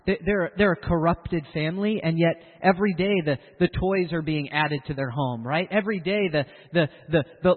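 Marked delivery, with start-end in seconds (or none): none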